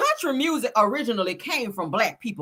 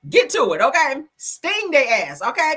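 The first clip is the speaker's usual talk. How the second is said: ordinary talk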